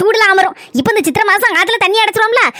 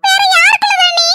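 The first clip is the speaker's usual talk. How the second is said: higher sharp mother-in-law query; suspicious mother-in-law; 10 semitones higher than usual. Acted. Mother-in-law interrogating new bride, higher suspicious voice. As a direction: pounce on the answer.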